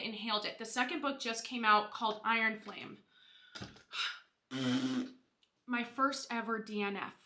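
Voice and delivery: usual speaking voice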